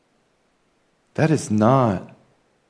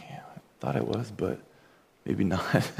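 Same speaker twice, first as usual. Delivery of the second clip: sheepish whisper; 8 dB quieter than usual. Retold or imitated. Sheepish confession to his wife.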